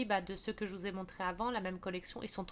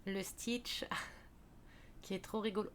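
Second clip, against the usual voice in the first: whispered aside; 2 semitones lower, 10 dB quieter than usual. Real, not acted. Cute figure, amused aside.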